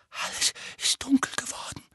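high, hoarse, shuddering whisper; heiser. A narrator's reading. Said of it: "sagte Dudley heiser und erschauderte". (heiseres, verängstigtes Flüstern)

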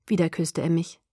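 ordinary delivery, nothing marked

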